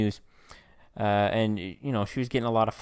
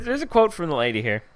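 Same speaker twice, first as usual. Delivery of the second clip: ordinary talk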